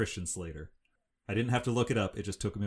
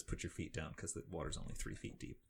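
dry aside; 2 semitones lower, 7 dB quieter than usual. Retold or imitated.

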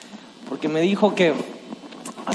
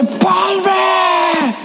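calling out; 11 semitones higher than usual. Retold or imitated. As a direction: shout his name from the stands. Coach calling from the stands.

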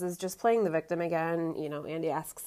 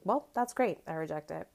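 dismissive; 4 dB quieter than usual. Retold or imitated. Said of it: "I've been saying that all week".

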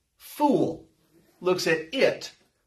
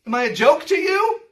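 higher, louder affronted question; indignant retort; 9 semitones higher, 4 dB louder than usual. Retold or imitated. God affronted by the idol worshipper, higher and louder. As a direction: sound insulted. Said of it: "He's thinking".

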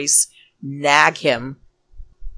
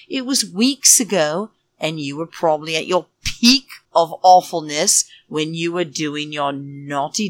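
ordinary talk, nothing marked

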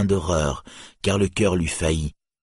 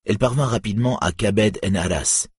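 plain reading voice

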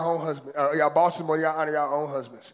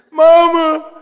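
loud, high yell of "Mama!"; screaming for mama; 10 semitones higher, 13 dB louder than usual. Retold or imitated. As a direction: cry out for mama like a grown man in trouble. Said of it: "three hundred pound man scream".

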